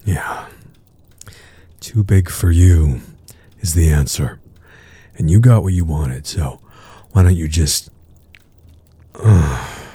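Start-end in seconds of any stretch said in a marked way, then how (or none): none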